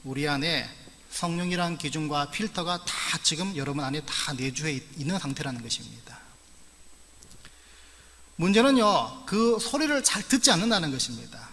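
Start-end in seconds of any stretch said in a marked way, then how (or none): 4.7-6.2 whispered. Real, not acted. Sentence end dropped to whisper.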